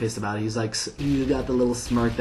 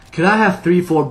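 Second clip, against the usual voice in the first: loud, high tipsy ticket request; mock-drunk, raised voice at the counter; imitated tipsy friend; 7 semitones higher, 8 dB louder than usual. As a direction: play a drunk kid ordering too loudly. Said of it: "like, tipsy".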